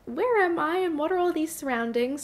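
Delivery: high-pitched imagined dog voice; wide-eyed "where am I" lilt; voicing the bewildered dog. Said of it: "She's like".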